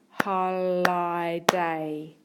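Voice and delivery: "holiday" very slow, the i said as eye; spelling-style "holiday" with a long i; sounding out. Shown as a demonstration. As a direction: stretch "holiday" into holl-eye-day. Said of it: "sounds that I can hear".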